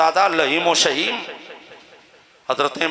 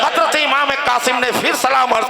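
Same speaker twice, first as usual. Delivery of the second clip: raised, high-pitched pulpit declamation; impassioned preaching; 6 semitones higher than usual. Acted. Preacher voices nephew and uncle before the last battle, impassioned preaching. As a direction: carry the dialogue in a ringing preacher's pitch. Preaches the dialogue at full force.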